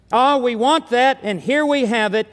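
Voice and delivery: ordinary talk, nothing marked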